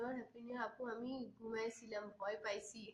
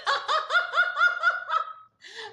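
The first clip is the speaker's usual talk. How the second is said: high, loud real laugh; laughing out loud at the comment; burst of laughter; 16 dB louder than usual. Real, not acted. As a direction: break into loud giggling. Laughs at being called wife.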